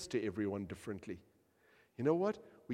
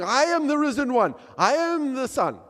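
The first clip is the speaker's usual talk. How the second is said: lecturing; 14 dB louder than usual. Retold or imitated. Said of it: "He starts giving her a lecture".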